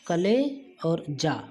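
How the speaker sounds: कले and जा said slowly as separate chunks; syllables split. Shown as a demonstration. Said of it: "कल का के साथ में ला पढ़ा जा रहा है". (कले और जा को धीरे, अलग-अलग बोलें)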